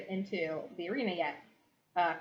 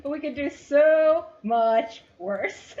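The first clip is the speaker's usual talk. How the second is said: slow menace; 8 semitones higher, 10 dB louder, much slower than usual. Acted. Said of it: "she's like".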